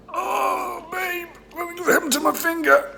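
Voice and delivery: very high, soft whining cry; plaintive wail. Pretend. Wailing to babe about a finger, high plaintive whine. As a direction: whine pitifully in a tiny high voice.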